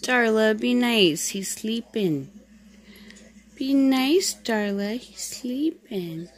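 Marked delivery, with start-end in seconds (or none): 0.0-1.3 loud scolding. Real, not acted. Scolding kitten biting sibling. 3.6-5.0 loud scolding. Real, not acted. Scolding kitten again.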